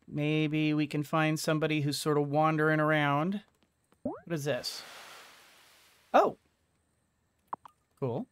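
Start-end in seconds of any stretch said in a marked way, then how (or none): none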